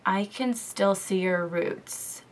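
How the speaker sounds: slow, soft retelling of his remark; quietly imitated boyfriend pointing out roots; slow quiet quote of boyfriend. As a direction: say it slowly and softly, as he did. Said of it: "Noah was like".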